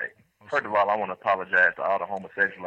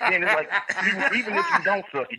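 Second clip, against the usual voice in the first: laughing; 11 semitones higher, faster than usual. Real, not acted. Laughing through his story.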